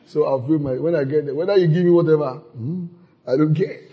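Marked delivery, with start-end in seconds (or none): none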